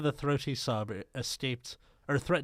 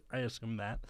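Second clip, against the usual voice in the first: ordinary delivery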